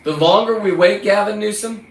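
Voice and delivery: loud and high at Gavin Newsom; angry call-out. Real, not acted. Fisherman demanding the governor show up, loud and high. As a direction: shout it to make him listen.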